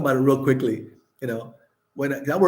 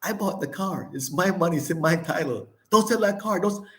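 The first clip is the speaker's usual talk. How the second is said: much higher, hammed-up mock scream imitating the ex-wife; imitating a frantic plea, pitched way up; 8 semitones higher than usual. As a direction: shriek it like she's chasing the car down the street, retold. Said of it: "she's screaming behind the car".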